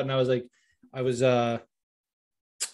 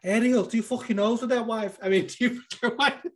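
high-pitched Scouse fighter impression; cheeky taunt, pitched well up and breaking into laughter; Darren; 8 semitones higher than usual. Fighter taunting Ariel in an interview, high mocking impression. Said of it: "And Darren's like".

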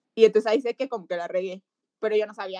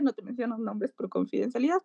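normal talking voice

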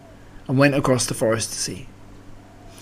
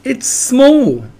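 high, loud exclamation; excited cry; 11 semitones higher, 5 dB louder than usual. A narrator's reading. Piglet recognises the lost friend, crying out. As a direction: squeal out the discovery. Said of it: "cried Piglet".

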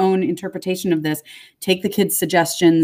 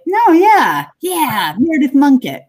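excited; 8 semitones higher, 5 dB louder, slower than usual. Retold or imitated. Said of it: "that you're like".

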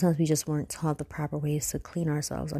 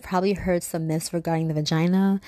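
intro voiceover; 3 semitones higher, 5 dB louder than usual. Acted. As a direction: announce the episode teaser. Recorded intro teaser.

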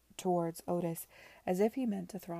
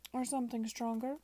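higher, suggestive villain's offer; raised pitch, lifting into the question; insinuating host; 5 semitones higher than usual. A narrator's reading. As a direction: tempt them with false hospitality.

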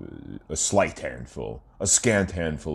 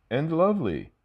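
high sultry; 12 semitones higher than usual. Acted. Sultry ad intro tagline.

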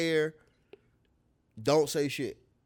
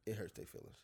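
mock whisper; 7 semitones lower, 17 dB quieter than usual. Mocking whispered aside.

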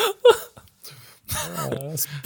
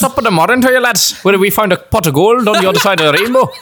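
hammed-up Irish brogue, pitched up; Irish accent; 7 semitones higher, 4 dB louder, faster than usual. Podcast host joining in with a mock Irish accent. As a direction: play the cartoon leprechaun Irishman. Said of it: "Iers accent".

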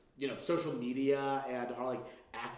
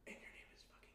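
whispering; 26 dB quieter than usual. Real, not acted. Conspiratorial aside about Katniss.

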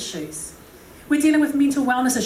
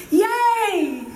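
high, drawn-out yay; mock cheer; 8 semitones higher than usual. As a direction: cheer it high like a delighted child. Cheering mid-quote at the psalm.

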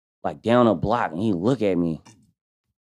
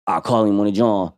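ordinary talk

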